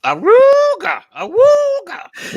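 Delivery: very high, drawn-out awooga howl; wolf howl. Pretend. Howling at wolf photo.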